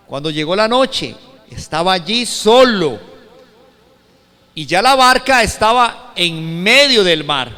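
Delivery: loud, emphatic preacher reading scripture; lectura bíblica declamada. Acted. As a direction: proclaim the Bible story forcefully to the room. Lee el pasaje bíblico con fuerza. (lectura bíblica proclamada con fuerza)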